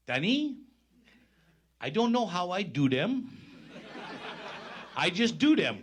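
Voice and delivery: high, loud imitation of a French accent with a laugh at the end; French accent impression. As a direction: mimic a French-accented hockey player, high and bright. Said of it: "in his French accents".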